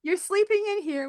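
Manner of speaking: high, bright burst of insistence, retold; emphatic insistence. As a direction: tell her firmly she's staying with you. Insisting friend sleeps in her tent.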